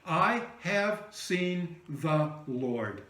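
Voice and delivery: each word set apart, slow and weighty; slow proclamation. Acted. Mary announcing to the disciples, slow solemn proclamation. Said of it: "It's a five-word sermon".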